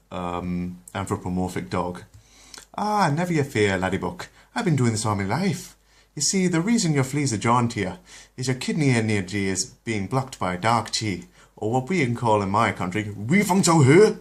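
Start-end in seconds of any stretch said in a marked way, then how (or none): 2.8-14.2 higher, jolly Irish brogue; Irish accent. Acted. The doctor reassures the dog in an Irish accent. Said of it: "a Chinese doctor with an Irish accent".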